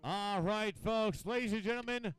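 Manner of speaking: loud, showy announcer delivery; event announcer. Real, not acted. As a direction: present them to the whole crowd. Announces the oldest competitors.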